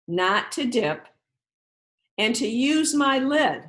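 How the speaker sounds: pledge to repeat. Shown as a demonstration. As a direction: lead the children through a pledge, one chunk at a time. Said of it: "repeat them after me".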